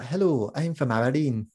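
slow, lilting imitation of an Aberdeen accent. Shown as a demonstration. Man contrasting Aberdeen with Glasgow, slow Aberdeen accent. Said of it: "whereas in Aberdeen, it's like".

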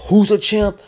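high-pitched hype shout to himself; retold inner yelling. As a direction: yell it like your own cheerleader. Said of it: "I'm, like, yelling in my head".

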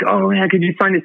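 eager fanboy, pitched high; hammed-up gushing autograph seeker; mocking fan. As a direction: beg like a starstruck fan chasing an artist. Mimicking fans chasing Rob Liefeld.

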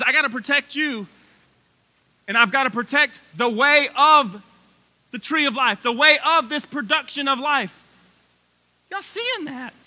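excited preaching, voice climbing high; getting excited. Real, not acted. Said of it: "I'm getting excited again. My voice keeps going up".

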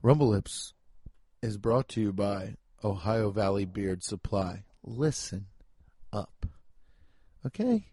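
hushed mock-ASMR with long pauses; ASMR ad read. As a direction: murmur the sponsor read close and unhurried. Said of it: "You hear that fucking A S M R?".

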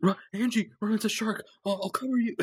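high, hammed-up shout of bravado; hammed-up warning to Angie; mock heroic [name].

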